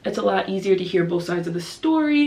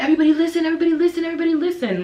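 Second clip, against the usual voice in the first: high, loud imitation of excited pupils; raised child voice calling everyone to attention; kids rallying classmates; 9 semitones higher, 4 dB louder, much faster than usual. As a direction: urgently hush the class like a little kid. Pupils urging each other to listen.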